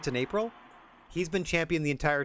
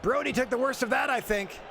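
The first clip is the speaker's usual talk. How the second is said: higher, shouting commentary; excited play-by-play; 9 semitones higher than usual. Acted. Commentator calling the brawl, rising shouted play-by-play. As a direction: hype every move.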